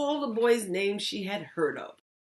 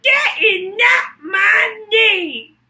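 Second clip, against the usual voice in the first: much higher, much louder, slow shouted goblin; 14 dB louder, slower than usual. Acted. Goblin taunts Lily with a deal, high slow shout. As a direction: bellow it like a gleeful goblin. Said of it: "smiled the goblin".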